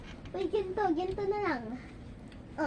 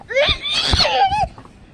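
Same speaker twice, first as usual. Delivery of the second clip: loud high laugh; 8 dB louder than usual. Real, not acted. Big laugh.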